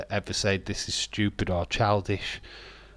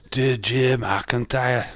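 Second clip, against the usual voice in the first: slow, drawn-out mock Scottish; a clumsy Scottish try, slow; bad Scottish accent; 3 semitones higher, 3 dB louder, much slower than usual. Shown as a demonstration. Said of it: "my Scottish accent is wank".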